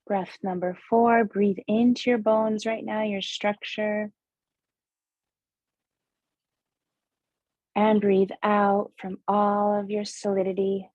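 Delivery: slow meditation guide, long pauses between breaths; guided breathing meditation. Acted. Host guides listeners through seven breaths, measured meditation voice.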